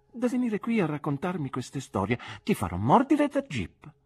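high girlish voice, mock threat; Dora threatening playfully. A narrator's reading. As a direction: threaten him like a sulky little girl, pitched high. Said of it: "rispose Dora". (minaccia per gioco, voce alta da bambina)

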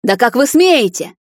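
loud, high, slow demand; outraged shout. A narrator's reading. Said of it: "тем временем кричала она".

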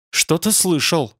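plain reading voice

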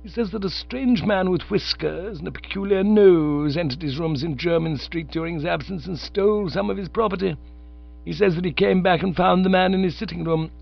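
a lightly voiced stern, formal aunt; Aunt Agatha's voice. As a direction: read it as a prim aunt laying down the law. Aunt Agatha explains the burglary.

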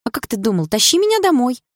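put-on girl bossing her boyfriend playfully; playful order. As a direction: order him to carry her home, teasing. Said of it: "приказала я".

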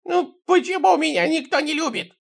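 very high mock-crying whine; tearful wail. A narrator's reading. Sidrych cries that nobody loves him, high tearful wail. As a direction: wail it like a hammed-up weeping old man. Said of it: "Сидрыч заплакал".

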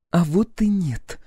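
stubborn, high and slow; drawn-out, high-pitched contradiction; Clementine. A narrator's reading. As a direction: dig in with a high female voice. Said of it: "упёрлась Клементина".

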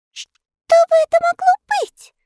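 high, tiny-voiced wondering; puzzled elves. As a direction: wonder aloud in a squeaky voice. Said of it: "удивлялись эльфы". (удивлённо)

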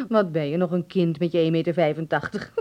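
ordinary talk

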